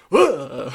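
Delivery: high, loud recoiling "ugh"; disgusted ugh. Retold or imitated. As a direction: imitate a grossed-out gasp. Retelling Dorian's reaction to Laudna.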